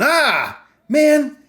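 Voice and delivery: high, loud "ah, man" cry; acted upset outburst. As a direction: blurt it out high and loud, imitating. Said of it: "instead of him going".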